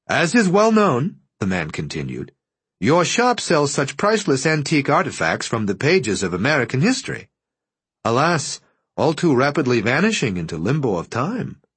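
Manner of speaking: a courteous caller choosing each word; formal visitor. A narrator's reading. To the dealer, the visitor speaks with stiff politeness.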